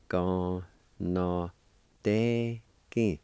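"Gon no te ki" in held, separate syllables; slow syllable-by-syllable. Shown as a demonstration. Teacher giving learners the second part, slow syllable-by-syllable. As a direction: pause between syllables of "Gon no te ki". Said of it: "Second part".

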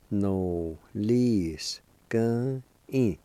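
"No, li, s, g, i" in slow syllables with shorter breaks; slow teaching demo of "No, li, s, g, i"; drawn-out pronunciation. Shown as a demonstration. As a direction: break "No, li, s, g, i" into its sounds. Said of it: "it's pronounced like this".

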